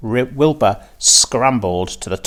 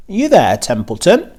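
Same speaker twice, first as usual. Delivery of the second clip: louder, higher call across the yard; a pig calling to the rat; 8 semitones higher, 5 dB louder than usual. A narrator's reading. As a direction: call out to the rat under the trough. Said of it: "he called".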